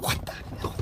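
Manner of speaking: voiceless, soft disbelief; hushed retold shock under the breath; stunned whisper. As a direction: whisper it, dumbfounded. Retells stunned reaction to the text.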